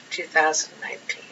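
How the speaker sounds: quick breathy whisper; fast hushed whisper. Acted. Whispered repeat of the date.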